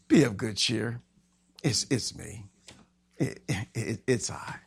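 low, soft, halting words; quiet reassurance. Acted. Jesus answering the screaming disciples, soft halting reassurance. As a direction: stammer it softly under your breath.

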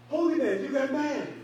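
high, loud teasing; mocking coworker. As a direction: gleefully rub it in. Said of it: "He said".

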